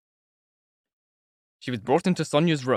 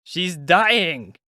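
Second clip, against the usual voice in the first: shouting; 6 semitones higher, 5 dB louder than usual. A narrator's reading. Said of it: "somebody shouted".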